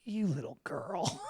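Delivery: low, soft, slow put-down; low scornful sneer. Retold or imitated. Host imitates Baldwin sneering quietly at the photographer. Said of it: "And he was just like".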